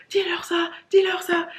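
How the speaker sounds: high pressing repeat; urgent plea. Retold or imitated. To her friend at work, a high urgent plea. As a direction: push your friend to translate.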